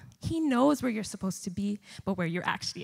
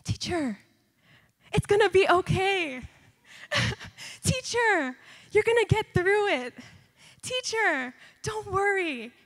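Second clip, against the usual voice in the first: put-on higher, louder voice of kids cheering up their teacher; comforting students; 10 semitones higher, 6 dB louder than usual. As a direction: reassure her like eager students, a high bright chorus.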